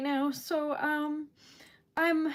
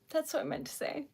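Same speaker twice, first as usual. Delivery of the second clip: ordinary delivery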